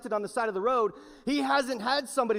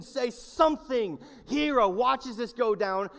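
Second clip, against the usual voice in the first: ordinary talk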